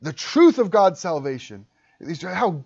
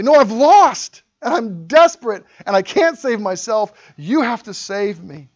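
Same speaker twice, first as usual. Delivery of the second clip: desperate cry; 5 semitones higher, 7 dB louder than usual. Retold or imitated. Sinner crying out in despair.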